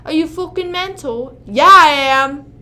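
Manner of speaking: mock British accent, loud on the answer; hammed-up British accent. Streamer trying the British phrase for chat. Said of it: "they say fucking mental".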